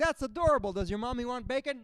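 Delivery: hammed-up cooing waitress. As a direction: coo it at a child. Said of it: "The waitress is like".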